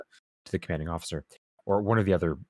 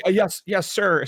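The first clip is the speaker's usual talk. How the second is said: much higher, louder eager compliance; eager yes-sir; 11 semitones higher, 6 dB louder, slower than usual. Retold or imitated. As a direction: hurry to obey like a flustered officer. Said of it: "And he's like".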